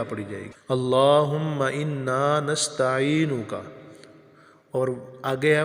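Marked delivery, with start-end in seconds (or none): 0.7-3.5 اللہم انا نستعینک with long vowels, slow; teacher's slow model recitation; slow careful recitation. Shown as a demonstration. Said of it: "یا عین کو لمبا کریں گے".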